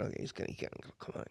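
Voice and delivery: fast whisper to himself; hushed, urgent whisper willing the player on; whispered inner thought. Retold or imitated. A poker dealer whispering his thoughts at the table. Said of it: "I'm sitting here thinking".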